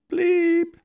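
high loud electronic beep; machine bleep. Retold or imitated. Mock answering machine giving the beep tone, high and loud. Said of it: "bleeping message machine".

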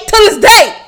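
high, loud mock boxer catchphrase; boxer meme voice. Repeating the meme, high mock boxer shout. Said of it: "there's a meme".

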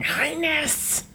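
high hissing lizard cry; slithering lizard. Acted. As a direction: hiss it out like a lizard. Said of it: "slithering lizard going".

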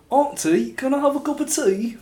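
hammed-up posh British voice, pitched high; fake English accent. Cousin asks auntie for tea, fake English accent. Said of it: "I got a cousin who was like".

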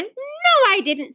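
high-pitched NO, then dropping; high protesting retort; indignant denial. A narrator's reading. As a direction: deny it like a squabbling child. Acted sisters' squabble.

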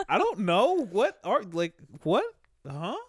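very high, loud, incredulous squeal; baffled alien reply. Pretend. The Mars people answering him, a baffled high squeal. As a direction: squeal back in total disbelief.

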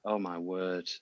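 ordinary delivery

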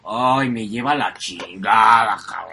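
low, long-drawn-out groan of a curse; sluggish groaned cursing. Retold or imitated. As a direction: imitate struggling to curse while frozen in sleep. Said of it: "le hago ... así".

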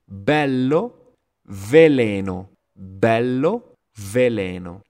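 loud, emphatic popped B in Bello, buzzed V in veleno; B versus V. Shown as a demonstration. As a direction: exaggerate lip B in Bello against lip-teeth V in veleno. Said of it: "para notar claramente su diferencia".

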